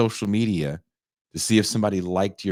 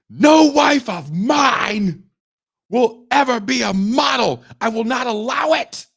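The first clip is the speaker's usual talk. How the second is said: much higher, louder, slow hammed-up bellow; mock husband shout; 8 dB louder than usual. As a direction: bellow the ban like a melodramatic husband. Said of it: "the husband goes".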